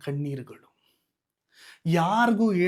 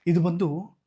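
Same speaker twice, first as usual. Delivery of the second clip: ordinary delivery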